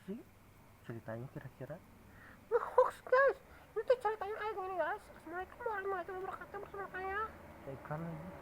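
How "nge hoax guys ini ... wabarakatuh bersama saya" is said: very high, squeaky little character protesting. Acted. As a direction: pitch way up like a tiny puppet saying no. Puppet character joins in. (suara tokoh kecil melengking tinggi)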